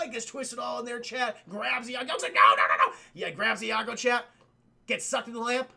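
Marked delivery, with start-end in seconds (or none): 2.3-2.9 very loud, low-pitched string of no's; mock panicked protest. Parrot grabbed as the lamp pulls them in, mock panic. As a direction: blurt it out in hammed-up panic.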